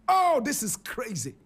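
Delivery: normal talking voice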